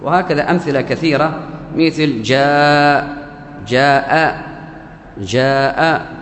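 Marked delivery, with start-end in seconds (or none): 1.8-6.1 جاء with its long alif held very slowly before the hamza; drawn-out madd. Shown as a demonstration. Teacher drilling the madd in جاء, very slow and stretched. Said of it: "عندي مد".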